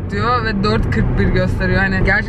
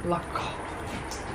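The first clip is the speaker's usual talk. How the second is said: whisper; 16 dB quieter than usual. Acted. Whispered curse over lost tile.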